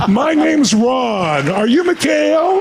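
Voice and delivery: high, very slow, drawn-out introduction; imitated Reagan. President introducing himself afresh to his rival, imitated stretched greeting. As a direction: stretch each word in a high impersonation. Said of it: "went back and went".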